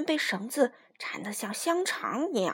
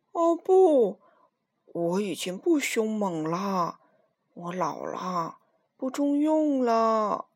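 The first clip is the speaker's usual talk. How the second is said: put-on slow, sighing old lion; sighing sadly; 2 semitones lower, slower than usual. Old lion lamenting his age to Minus, slow sigh. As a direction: let it sag like a tired old beast. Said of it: "叹了一口气".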